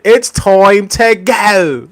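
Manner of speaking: slow, high, drawn-out imitation; mock catchphrase.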